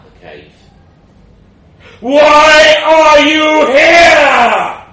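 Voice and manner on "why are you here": huge, drawn-out high roar; thunderous dragon shout, every word stretched; bellowing dragon. A narrator's reading. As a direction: bellow it slowly as a mighty dragon. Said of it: "it bellowed".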